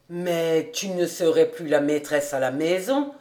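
low man's voice, raising a doubt; objecting master. Acted. Master warning housekeeper she would lose her rule, low voice.

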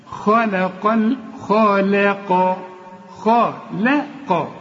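خلق drawn out very slowly, lips forward for the kha; slow careful khalaqa. Shown as a demonstration. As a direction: spell out خلق syllable by syllable. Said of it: "لا". (نطق خلق ببطء شديد بتفخيم الخاء)

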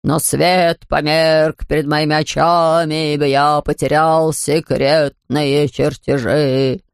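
low, very slow quavering proclamation. Acted. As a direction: proclaim it slowly like an old herald. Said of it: "возгласил он дребезжащим голосом".

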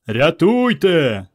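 long, slow bellow for help; bass bellow. A narrator's reading. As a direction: bellow it like a big lad. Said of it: "басом гаркнув Іван".

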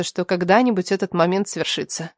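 plain reading voice

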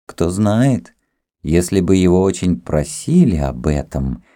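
plain reading voice

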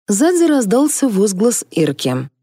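ordinary delivery

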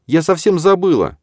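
high, loud panicked realisation; dismayed. A narrator's reading. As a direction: blurt it in alarm. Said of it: "просипела Ирида".

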